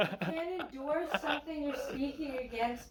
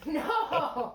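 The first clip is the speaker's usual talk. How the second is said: playful shout; 6 dB louder than usual. Real, not acted. Playful protest at his joke.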